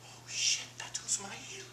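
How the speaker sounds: whispered inner panic; whispered thought. Retold or imitated. Comic recognizing an old coworker, whispered panic. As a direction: whisper it under your breath like a private thought. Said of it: "thinking to myself".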